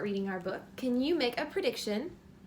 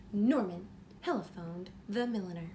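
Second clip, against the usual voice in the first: bright, lively storybook reading; reading to children; 2 semitones higher, 7 dB louder than usual. A narrator's reading. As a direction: read it like bedtime story time for a little one. Reads picture book aloud.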